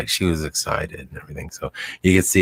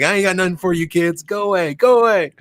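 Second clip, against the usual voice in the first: high, put-on voice of a fed-up dog dad, brushing the pups off; deadbeat dog dad; 13 semitones higher, 4 dB louder than usual. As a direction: shoo the kids away as the grumpy dog dad. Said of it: "he's like".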